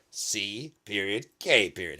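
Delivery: C and K spaced out slowly; deliberate spelling. Acted. Comic spelling out his surname, slow and deliberate.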